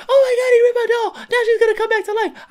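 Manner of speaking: put-on very high, squealing little-girl voice; mock a panicked little cousin, high and loud; shrieky, hammed-up child impression. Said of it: "She was like".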